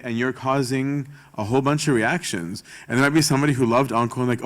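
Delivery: normal talking voice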